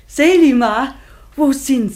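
bright, carrying call across the distance; joyful calling out. Acted. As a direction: call her name loud and high. Mother calls out joyfully.

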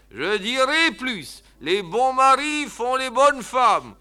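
put-on slow, high old man's sermonizing; pompous slow old uncle; deaf old uncle. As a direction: lay down the maxim slowly like a deaf old uncle. Deaf uncle pronounces a maxim.

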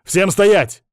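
loud, high-pitched order; commanding shout. A narrator's reading. Hostage-taker ordering the guards to freeze, commanding shout. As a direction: shout them to a halt.

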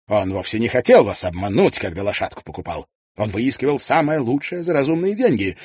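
Tietjens voiced. Tietjens advising Mrs Wannop on her cart, brisk and confident. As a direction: rattle off the practical advice with assurance.

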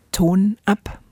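normal talking voice

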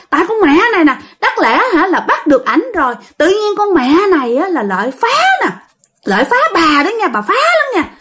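put-on high, simpering girlish voice; coy woman spirit. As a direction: coo it like a lovestruck ghost girl. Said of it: "nói giọng đàn bà luôn".

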